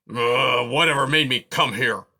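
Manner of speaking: loud, whiny self-questioning; grumbling porcupine. A narrator's reading. Aerith asking himself why he came, whiny and grumbling. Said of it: "he kept asking himself".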